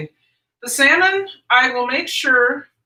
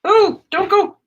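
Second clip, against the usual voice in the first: talking to a dog; 10 semitones higher, 5 dB louder than usual. Real, not acted.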